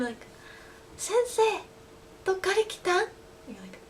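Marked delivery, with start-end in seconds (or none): 1.0-3.1 put-on high, childlike Sensei call; little kid voice. Small kid asking the teacher where she's from, childlike voice. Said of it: "little kids come up to you".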